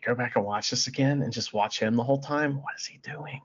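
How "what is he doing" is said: whispered in disbelief; hushed bewildered whisper; baffled whisper. Real, not acted. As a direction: breathe it out, puzzled. Baffled by wrestler's behaviour.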